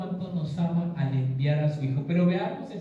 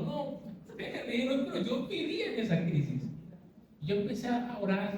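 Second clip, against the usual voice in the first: usual speaking voice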